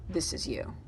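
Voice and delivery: ordinary talk, nothing marked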